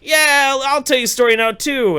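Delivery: loud, high boozy shout; drunken holler. Retold or imitated. Drunk host offering his own story, loud and high. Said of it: "I'm drunk as fuck".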